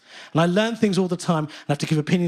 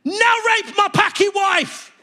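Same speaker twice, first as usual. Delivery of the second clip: high, loud, slow furious scream; 4 dB louder, much slower than usual. Retold or imitated. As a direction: roar it as he throws him down. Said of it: "throwing him on the floor and screaming".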